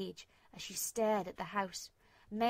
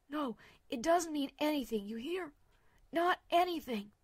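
higher, louder urgent reassurance; insistent friend; 5 semitones higher, 4 dB louder than usual. Acted. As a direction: press it on her, high and firm.

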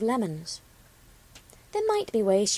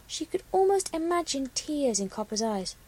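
usual speaking voice

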